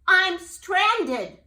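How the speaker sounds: high, loud cry; desperate shout. Acted. Jubal stuck on the toadstool, shouting. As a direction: shout in panic.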